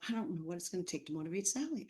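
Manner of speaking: quick, soft muttered thought; inside thought. Retold or imitated. Teacher quietly thinking about a late student, hushed and quick. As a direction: think it to yourself fast and hushed. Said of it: "this inside thought of".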